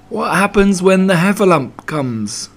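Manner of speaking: high, slow, anxious question; trembling. A narrator's reading. Piglet fearing the Heffalump's arrival, trembling. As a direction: ask it slowly with dread.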